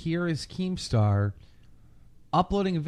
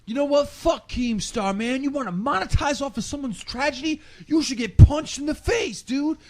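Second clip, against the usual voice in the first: mock angry troll yelling; 11 semitones higher, 4 dB louder than usual. Keemstar imitating an angry vlogger cursing him. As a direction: rant it like a furious vlogger. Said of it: "He's like".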